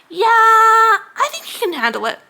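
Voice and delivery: mock-sweet managerial refusal; mock boss. Imitating boss refusing overtime.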